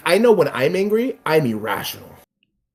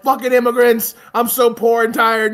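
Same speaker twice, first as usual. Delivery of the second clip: mock angry whiner; 8 semitones higher, 4 dB louder than usual. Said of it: "They're like".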